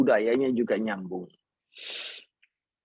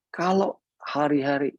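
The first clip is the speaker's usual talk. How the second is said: ordinary talk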